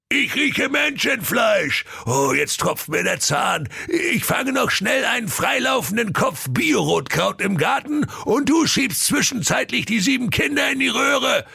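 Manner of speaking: booming greedy ogre voice; a hungry ogre giving orders; merciless ogre. Acted. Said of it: "der unbarmherzige Karl-Heinz Menschenfresser sprach".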